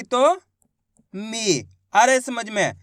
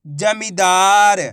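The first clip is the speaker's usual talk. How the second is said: very slow, louder जमींदार drawn out in full; slow blended word; 3 semitones lower, 6 dB louder, much slower than usual. Shown as a demonstration. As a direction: present जमींदार slowly as the finished word. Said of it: "मैं किस तरीके से एक एक अक्षर का उच्चारण करके". (जमींदार को बहुत धीरे और ज़ोर से)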